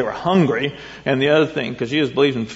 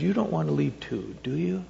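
tender question; 9 dB quieter than usual. Acted. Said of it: "the humanity of Jesus".

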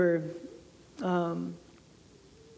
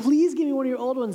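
higher, fast pleading; 6 semitones higher, 4 dB louder, faster than usual. Retold or imitated. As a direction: beg mom eagerly.

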